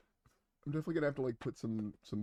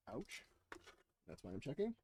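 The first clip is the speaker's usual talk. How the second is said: muffled inside a knight helmet; putting helmet on; 10 dB quieter than usual. Real, not acted. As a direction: voice boxed in by a helmet. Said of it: "I'm gonna put the helmet on real quick".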